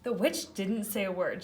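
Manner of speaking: usual speaking voice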